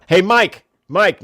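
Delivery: loud high shout across the room; captain shouting for Mike across the office, loud; calling out. Retold or imitated. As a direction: yell the name to get his attention.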